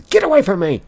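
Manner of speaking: loud high yell, pushing someone off; angry shout. Acted. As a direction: shove them away with a shout. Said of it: "May pushes Elijah away and says".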